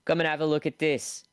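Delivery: the cleaner shouts into the dark bedrooms; loud shout. A narrator's reading. Cleaner yells to the sleeping family, loud shout. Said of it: "shout loudly into the darkness".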